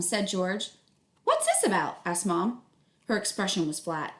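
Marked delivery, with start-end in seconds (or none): 1.2-2.0 high, pointed mother's question; sharp high mother voice; stern mom. Acted. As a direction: demand it like a suspicious mom. Mom confronting George.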